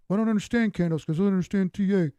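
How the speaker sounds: quick, mock-confused viewer voice; mock clueless viewer. Chart guy mimics a viewer who doesn't get candles, mock-confused. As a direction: rattle it off like a lost newbie.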